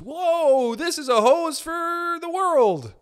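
high, slow, awestruck dopey voice; a goofy hero gasping at his giant hose; dopey firefighter. Pretend. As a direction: play a dazed firefighter marvelling at his hose. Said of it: "That's me as a firefighter".

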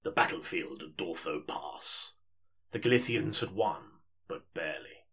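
measured audiobook narration; story narration. Acted. Narrator opening the fantasy audiobook, measured storytelling. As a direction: read it like an epic audiobook.

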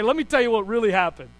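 usual speaking voice

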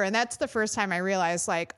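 usual speaking voice

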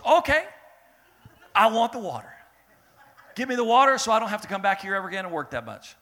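high, loud, fast eager begging; snatch at the offer; eager plea. Acted. Woman begging Jesus for water.

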